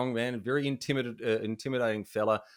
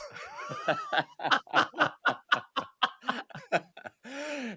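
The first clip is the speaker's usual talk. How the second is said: long laugh pitched way up; long high laugh. Real, not acted. After the signed-bat punchline, long high laugh. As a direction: crack up at your own punchline.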